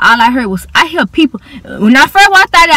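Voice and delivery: ordinary talk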